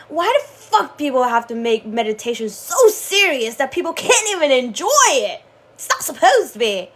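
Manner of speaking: loud, heated outburst; angry venting at society; furious rant. Real, not acted. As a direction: rant at the world, fed up. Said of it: "I don't know why I get so furious".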